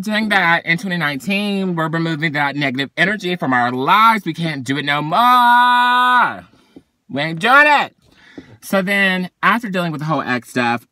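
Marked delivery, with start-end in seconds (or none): 4.2-7.9 drawn-out, higher and louder; sassy declaration. Real, not acted. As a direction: lay down the law with attitude. Declaring she's done with negativity.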